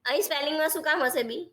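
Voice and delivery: usual speaking voice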